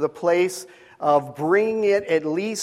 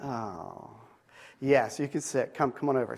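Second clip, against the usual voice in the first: tender hush; 4 semitones lower, 9 dB quieter than usual. Retold or imitated. Father answers daughter softly.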